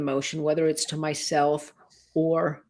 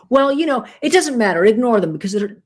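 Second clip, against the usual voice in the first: dismissive parent; 4 semitones higher, 7 dB louder than usual. Pretend.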